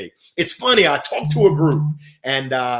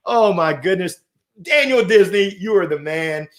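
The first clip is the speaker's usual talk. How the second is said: usual speaking voice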